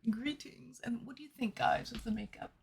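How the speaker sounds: soft, breathy whispered greeting; whispering the question. Real, not acted. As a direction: lean in and whisper to the chat. Whispered greeting to stream chat.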